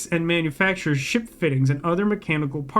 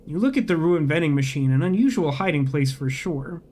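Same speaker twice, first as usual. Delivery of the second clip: ordinary talk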